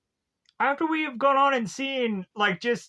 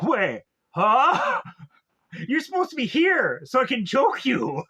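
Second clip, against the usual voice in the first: surprised villain; 2 semitones higher, 4 dB louder than usual. Retold or imitated. Villain finds the girls gone.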